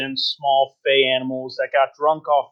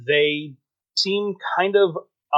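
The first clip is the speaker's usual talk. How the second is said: ordinary talk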